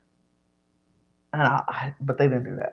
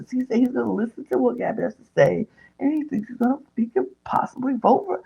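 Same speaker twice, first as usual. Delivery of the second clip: high, hurried gossip; mock agitation; 8 semitones higher, faster than usual. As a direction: retell it as a worked-up friend spilling news. Retelling Danielle's worked-up report to Brittany.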